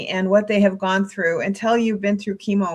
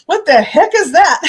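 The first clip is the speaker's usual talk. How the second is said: higher, louder startled cry through a laugh; imitated shocked reaction, pitched up and loud; 7 semitones higher, 5 dB louder than usual. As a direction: act out baffled shock, half laughing. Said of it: "you're just shocked".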